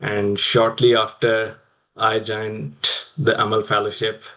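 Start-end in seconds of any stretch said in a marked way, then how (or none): none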